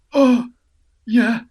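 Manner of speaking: high, loud retold reaction; mock taken aback. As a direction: act out a startled, high-pitched agreement. Retold startled reaction to director's request.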